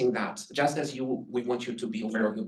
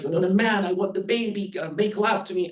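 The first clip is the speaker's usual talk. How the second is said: high, louder mock scream of the wife; retold woman's scream; 8 semitones higher, 3 dB louder than usual. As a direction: shout it like the wife in the film, higher. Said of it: "woman screams at this".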